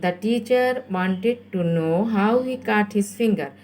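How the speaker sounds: drawn-out, word-by-word sentence; very slow dictation. Shown as a demonstration. Teacher repeats the answer for pupils writing, very slowly. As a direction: dictate slowly so learners keep up. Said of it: "আমি খুব আস্তে আস্তে বলছি".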